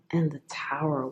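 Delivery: whispered card name; hushed reveal. Real, not acted. Reader drawing the Tower card, whispering. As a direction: let the last card drop to a whisper.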